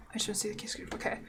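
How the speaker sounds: whispered read-along; whispering. Acted. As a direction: breathe the words out in a whisper. Reading the game line along.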